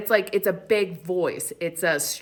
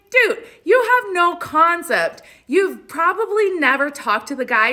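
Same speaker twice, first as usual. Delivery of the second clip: incredulous, pitched-up dressing-down; exasperated retort; 10 semitones higher, 6 dB louder than usual. Retold or imitated. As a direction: tell them off in emphatic exasperation. Retells scolding conspiracy believers.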